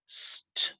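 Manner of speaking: "St-o-n" whispered sound by sound. Shown as a demonstration. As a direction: breathe out "St-o-n" one sound at a time, no voice. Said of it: "the word stone".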